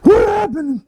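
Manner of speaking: high mock-wailing victim; dazed blast victim. Friend mocks a man splattered by a blast, high wail. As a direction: wail it high, hamming up the shock.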